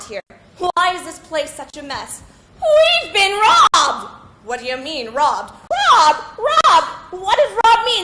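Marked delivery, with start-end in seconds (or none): 2.6-4.1 loud, slow wail of robbed; distressed cry. Acted. Wife telling husband of robbery. 5.5-8.0 high, loud repeated robbed; exasperated shout. Acted. Wife snapping at husband.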